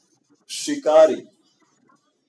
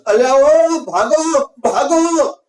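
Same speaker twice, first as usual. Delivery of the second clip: much higher, loud warning cry of "ભાગો"; alarmed shout; 9 semitones higher, 7 dB louder, slower than usual. Acted. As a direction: yell at the flock to run for it. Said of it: "એણે બૂમ પાડી". (ગભરાટભરી જોરદાર બૂમ)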